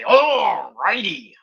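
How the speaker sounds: very slow stretched pirate alrighty; drunk pirate. Retold or imitated. Mocking a drunk pirate saying alrighty, slow and stretched. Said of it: "a drunk pirate would be able to say".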